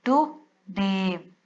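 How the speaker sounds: टुडे said very slowly as टु-डे; split into syllables. Shown as a demonstration. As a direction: break टुडे cleanly into two syllables, drawn out. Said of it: "इस consonant से पहले हम इसको divide कर देंगे". (टुडे को धीरे-धीरे दो सिलेबल में तोड़कर)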